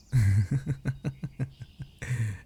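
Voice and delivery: a full, long laugh trailing down; hearty laugh. Real, not acted. Remembering holiday parties with friend, hearty laugh. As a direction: laugh freely at a shared memory.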